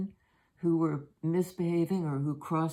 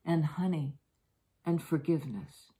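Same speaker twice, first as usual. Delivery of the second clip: poem reading. Acted. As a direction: read it like a blessing, unhurried. Reads a devotional poem aloud.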